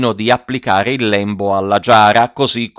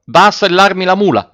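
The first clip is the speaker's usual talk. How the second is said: high, raised-voice command; sharp command, louder and higher; urgent order; 9 semitones higher, 5 dB louder than usual. A narrator's reading. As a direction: order the farmhand off at once. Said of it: "ordinò al contadino".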